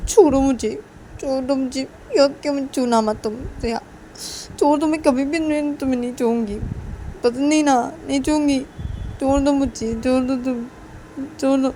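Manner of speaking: acted distressed refusal; upset protest. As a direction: fight off the hug, loud and hurt. Struggling out of an unwanted hug.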